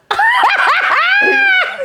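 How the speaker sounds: high-pitched burst of laughter; long, high laugh; real laugh. Real, not acted. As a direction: crack up at your own story. Laughs at own story.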